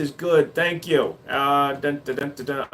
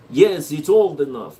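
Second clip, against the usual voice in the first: put-on Russian accent; 2 semitones lower than usual. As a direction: say it as a mock Russian. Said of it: "Americans doing Russian accents always sound like this".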